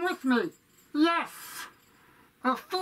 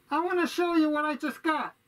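usual speaking voice

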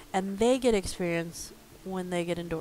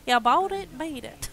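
higher mock catchphrase, loud at the start; exaggerated catchphrase loop; 7 semitones higher, 6 dB louder than usual. Shown as a demonstration. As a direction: punch up the catchphrase, then drop off. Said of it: "You could just loop".

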